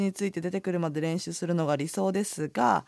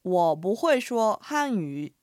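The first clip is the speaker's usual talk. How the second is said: slow, careful Mandarin model; slow teaching demo; slow model phrase; much slower than usual. Shown as a demonstration. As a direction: spell out each syllable slowly. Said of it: "では解説していきますね".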